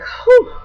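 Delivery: high, quick whew of relief; relieved whew. Retold or imitated. Relief after pain stops.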